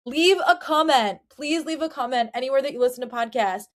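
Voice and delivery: ordinary talk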